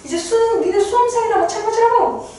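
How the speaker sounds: high nagging scold; high mock scolding of a student; nagging dog. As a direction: scold a drowsy exam student. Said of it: "펜트하우스 보고 있을 때".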